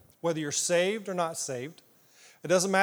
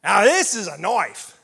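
louder Crocodile Dundee knife line; Crocodile Dundee impression; 7 dB louder than usual. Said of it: "Crocodile Dundee".

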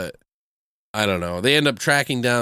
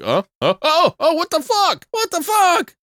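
very high-pitched imitated panic; hammed-up shocked realization; mock outraged alarm; 4 dB louder than usual. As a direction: squawk it in comic disbelief, climbing higher. Jackson discovers his wallet is gone.

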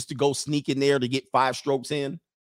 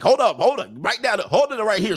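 urgent mock call; 6 semitones higher than usual. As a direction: playfully act out panicking mid-act. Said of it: "You be like".

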